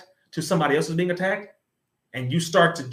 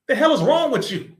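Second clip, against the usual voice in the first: angry; 8 semitones higher, 5 dB louder than usual. Real, not acted. Angry rebuke of hosts.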